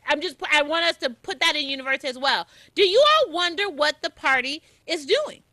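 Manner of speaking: ordinary talk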